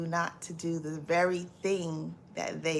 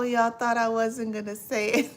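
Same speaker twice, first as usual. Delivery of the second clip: usual speaking voice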